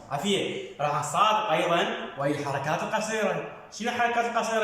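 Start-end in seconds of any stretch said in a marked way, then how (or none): none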